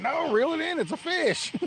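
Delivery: high and loud, urging him on; excited retort. Retold or imitated. Retelling telling friend to reel in, excited high shout.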